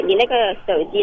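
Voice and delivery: ordinary delivery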